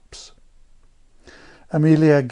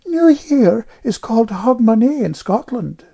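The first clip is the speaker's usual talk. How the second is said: put-on high, louder piping girl; piping know-all child; 11 semitones higher, 5 dB louder than usual. A narrator's reading. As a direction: pipe up like a little know-all. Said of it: "piped Amelia".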